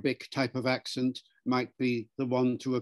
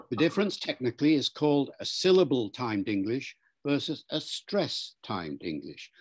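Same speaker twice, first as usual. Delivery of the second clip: usual speaking voice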